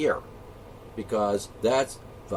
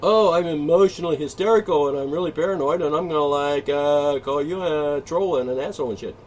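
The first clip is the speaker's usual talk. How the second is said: high, loud mocking whiny voice; mock hysterical whiner; 3 semitones higher, 5 dB louder than usual. Mocking an offended listener, hammed-up hysterical whine. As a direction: ham up a hysterical snowflake. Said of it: "We don't go".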